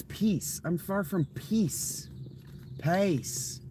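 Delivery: slow, high mock southern accent drawling; southern accent parody, high and slow. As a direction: poke fun with a southern accent, stretched out. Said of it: "Even his fucking southern accent".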